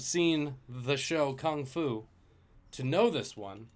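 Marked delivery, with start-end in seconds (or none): none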